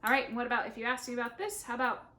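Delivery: ordinary talk, nothing marked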